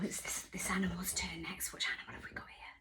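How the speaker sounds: hushed, fast teasing whisper; secretive storyteller whisper. Acted. Teasing children about next animal.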